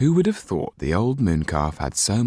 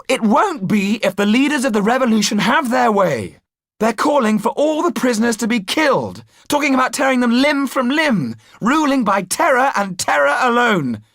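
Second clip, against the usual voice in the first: put-on higher, louder, urgent young hero; Jan's voice; 6 dB louder than usual. A narrator's reading. Jan warning about the revolution's leaders, higher and urgent. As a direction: hammer home the danger.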